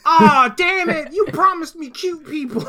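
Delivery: high whiny cry of betrayal; mock outrage. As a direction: squeal it like a cheated kid.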